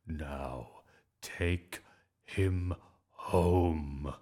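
measured words with pauses between; stern order. A narrator's reading. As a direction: spell out the command, building to the end. Angry final order.

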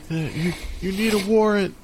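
high nervous stammering question; a scared teen bluffing; shaky voice. Retold or imitated. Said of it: "his voice is shaking".

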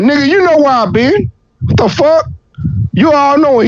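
high-pitched comic indignation; indignant Satan. Acted. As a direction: answer back as if the question is absurd. Satan snaps back at God, acted.

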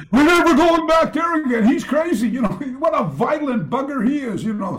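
high, loud mock-panicked voices; mock fleeing students. Students fleeing the seminar, high and loud in mock panic. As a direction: ham up scared students running away.

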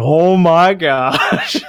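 excited gush. Retold or imitated. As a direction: stretch it out like a thrilled fan. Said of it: "So I was like".

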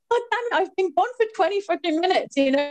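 high, exasperated reproach; retold outburst. Woman retelling berating the crew who never came, high exasperated outburst. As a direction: re-enact losing it, high and insistent. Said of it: "I'd lost it".

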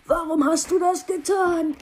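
crying out in pain, loud and strained; hurt and accusing, shout it out. Acted. Audio file cries out in pain.

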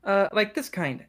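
put-on high little-girl voice; high-pitched child character; little elven girl. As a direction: answer as a small child showing off. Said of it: "And she waves her hand".

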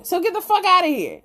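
high-pitched, disbelieving scoff; scornful dismissal. Retold or imitated. Friend calls out happy hour drinking, high and scornful. Mocking his claim of cutting back.

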